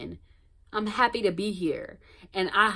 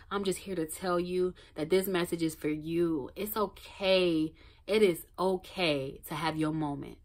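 ordinary talk, nothing marked

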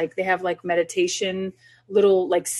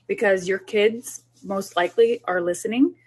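ordinary talk, nothing marked

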